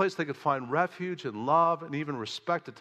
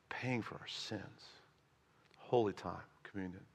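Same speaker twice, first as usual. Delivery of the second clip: reverent whisper; 6 semitones lower, 11 dB quieter than usual. Real, not acted. Reverent hush after the cross.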